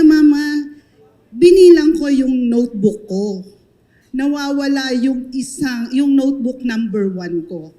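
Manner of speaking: a kinder pupil upset over a lost notebook; child's complaint. Retold or imitated. Mother retells her crying child at pickup, loud worried plea.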